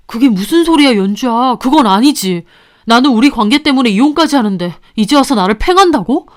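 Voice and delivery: lightly voiced husband, wounded; hurt husband. Husband reproaches the mistress, hurt. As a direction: protest that he gave up everything.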